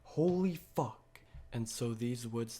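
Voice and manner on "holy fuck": higher, louder, slow awed gasp of holy fuck; retold awe at the world flipping; stunned disbelief. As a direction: drag out holy fuck in amazement. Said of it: "and I was like".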